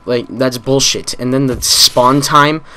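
normal talking voice

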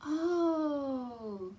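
ordinary delivery

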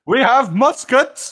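high, loud and slow declaration; mock threatening proclamation. Settlers warning natives of their weapons, mock threatening proclamation. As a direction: announce it slowly as a hammed-up threat.

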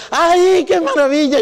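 high, loud cry of delight; retold childish delight, loud and high; overjoyed. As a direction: burst out with joy at the sight of home. Arriving home, overjoyed.

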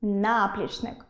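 наплічник as НАплічник; наплічник with the first syllable stressed. Shown as a demonstration. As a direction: lean slowly on НА in наплічник. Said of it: "спробуйте вимовити голосніше кожен з них".

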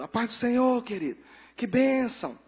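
mock cheerful brethren hello; churchy greeting. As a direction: sing out the greeting like a church member. Church folk greeting each other.

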